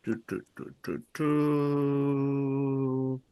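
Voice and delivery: quiet repeated "Tu" tapped out softly; soft tu syllables. Shown as a demonstration. Teacher softly sounding out tu syllables. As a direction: murmur "tu, tu" as a sound drill. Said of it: "this one here".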